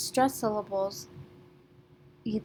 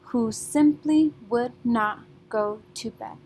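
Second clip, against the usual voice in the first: three strong beats on simply, not, bed; stressed beats; 4 dB louder than usual. Shown as a demonstration. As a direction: punch the first syllable of simply, then not and bed. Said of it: "is a stressed syllable".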